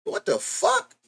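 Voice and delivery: high squeaky disbelief; a stunned streamer yelping at his own screen; shocked. Real, not acted. Streamer reacting to broken camera, stunned high yelp.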